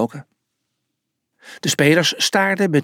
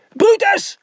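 short high-pitched yell of a name; shout; 4 dB louder than usual. A narrator's reading. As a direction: yell it in one quick burst. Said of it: "schreeuwde hij".

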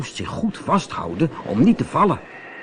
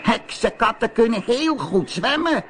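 high witch voice, surprised; sea witch calling; 11 semitones higher than usual. A narrator's reading. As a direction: ask it like a curious witch. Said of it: "riep de zeeheks".

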